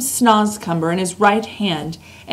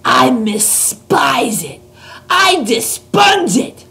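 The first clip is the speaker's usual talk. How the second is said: higher, loud, slow giant outcry; disgusted cries; 6 semitones higher, 5 dB louder, slower than usual. A narrator's reading. Giant crying out his disgust at the snozcumber, loud and high. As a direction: hurl each word at the snozcumber. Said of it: "cried the B F G, waving it about".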